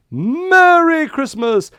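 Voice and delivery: high, loud, slow festive shout; cheerful shout. Acted. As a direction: call it out big for the kids. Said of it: "everyone say".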